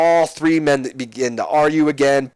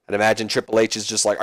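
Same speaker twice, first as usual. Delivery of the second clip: ordinary talk, nothing marked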